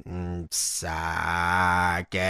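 low, slow, soft electrolarynx drone; robotic voice box. Retold or imitated. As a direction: speak like through a voice box. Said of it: "and Kane".

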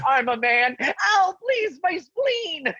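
very high, sobbing mock plea; mock crying plea. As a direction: whimper for mercy, hammed-up. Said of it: "You know".

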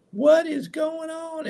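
high, slow baffled cry; exasperated host stuck with dead audio. Real, not acted. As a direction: sound truly thrown by the glitch. Mic trouble on going live.

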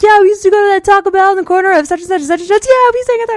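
high, loud, fast gushing imitation; imitating Kyle's eager high school chatter; mock eager chatter. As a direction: rattle it off like an overeager chatterbox. S2 mimicking Kyle chatting eagerly.

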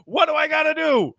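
high, pleading mock whine; frustrated Zach quote. Host imitating Zach pleading for help, high mock whine. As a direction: play the overworked star begging for help.

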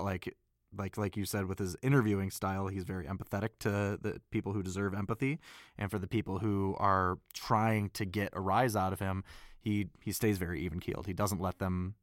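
usual speaking voice

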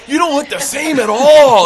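high, loud mock astonishment; imitated bro disbelief; amazed surfer. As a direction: exclaim it like a stunned surfer dude. Surfer amazed at old friend.

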